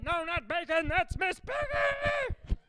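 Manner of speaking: very high, slow squeaky Muppet shriek; hammed-up Muppet voice. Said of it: "outgrew Muppet voices".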